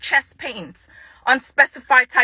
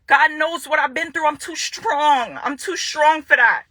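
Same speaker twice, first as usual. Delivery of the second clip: usual speaking voice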